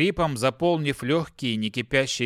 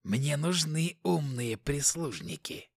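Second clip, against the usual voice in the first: demonic whisper; 4 dB quieter than usual. Acted. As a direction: purr it like a scheming demon. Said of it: "голос демона".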